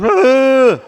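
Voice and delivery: loud high yelp; mock scream. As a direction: yelp like a panicked guy. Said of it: "You don't just run around going".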